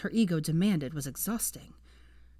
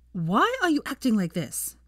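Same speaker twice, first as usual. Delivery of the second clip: louder, higher, full of stored-up spite; venomous outburst; 5 semitones higher, 4 dB louder than usual. Acted. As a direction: let the question burst out with venom. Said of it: "burst into the silence, laced with all the venom".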